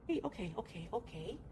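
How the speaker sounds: calm a scared cat gently; soothing the cat. Real, not acted. Calming the frightened cat.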